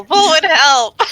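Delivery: yelling for help. Acted. Elora holding on to a falling friend, yelling. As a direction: call out urgently to a companion. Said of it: "Elora will yell".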